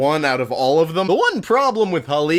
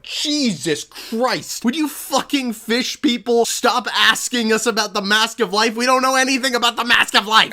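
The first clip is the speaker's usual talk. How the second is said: a villager sick of being asked again and again; exasperated Matoran; 7 semitones higher than usual. Acted. Villagers snap at the fish-people Toa, higher and exasperated.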